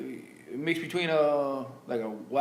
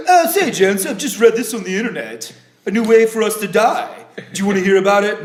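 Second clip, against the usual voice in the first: Buzz Killington voice; 2 semitones higher, 7 dB louder than usual. Pretend. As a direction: announce doom like a fussy gent. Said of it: "your character of Buzz Killington".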